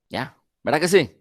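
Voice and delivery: usual speaking voice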